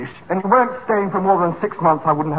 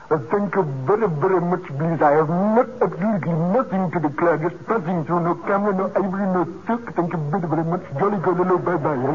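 put-on Indian accent. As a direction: demonstrate a comic Indian accent. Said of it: "Please try and do an Indian".

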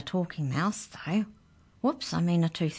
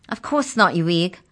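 snapped; 3 semitones higher, 7 dB louder than usual. A narrator's reading. Girl stopping Arthur from opening the cage, snapping. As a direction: snap it out, raised and emphatic. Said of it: "snapped Mallory".

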